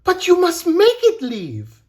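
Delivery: high, loud urging; insistent. A narrator's reading. Mirette urges Bellini to beat fear, insistent. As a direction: insist at the top of a girlish voice. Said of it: "Mirette insisted".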